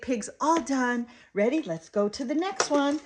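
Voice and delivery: put-on high, lilting wrap-up for a toddler; eager sing-song parent voice; upbeat play talk. Shown as a demonstration. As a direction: move the child along cheerfully. Still modelling talk to child.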